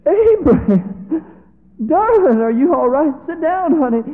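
high, loud, frightened pleading; a wife terrified at her husband's words; alarmed wife. Acted. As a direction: beg him to sit down, scared for him.

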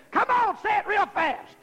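imitated urging. Imitating preacher boy egging someone on.

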